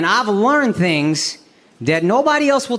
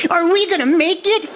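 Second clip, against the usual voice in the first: high pleading whine; mock needy boyfriend; hammed-up pitiful plea; desperate boyfriend; 11 semitones higher than usual. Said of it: "Texting her".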